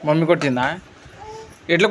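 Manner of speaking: father coaxing his son down. Real, not acted. Father talks to his small son.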